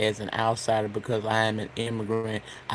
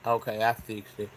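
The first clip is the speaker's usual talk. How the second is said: ordinary talk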